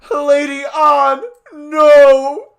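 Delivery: very high, loud crying shout; yelled. Acted. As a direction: wail it out sobbing. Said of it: "Morgana yelled".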